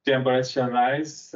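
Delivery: ordinary delivery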